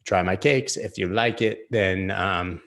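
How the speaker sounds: a half-hearted Jamaican dealer voice; bad Jamaican accent. Retelling the weed seller, mock Jamaican accent. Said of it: "That was not a Jamaican accent".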